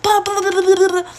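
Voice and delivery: high, loud mocking of a screaming mother; mom screaming. Kicking her daughter out, mom screaming in mock fury. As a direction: spit it out like an angry mom. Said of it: "she starts screaming at me".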